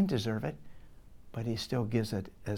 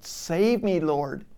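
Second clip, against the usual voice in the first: higher, louder cry for help; retold scream; 5 semitones higher, 5 dB louder, slower than usual. Peter sinking in the water, crying to the Lord, mock scream. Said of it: "he screams out".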